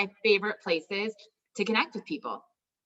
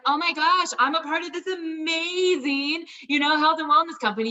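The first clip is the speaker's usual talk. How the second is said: much higher and louder, a forced bubbly sales voice; fake gushing excitement; 8 semitones higher, 5 dB louder than usual. Shown as a demonstration. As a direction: ham up phony enthusiasm for a pitch. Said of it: "You wouldn't sound like that".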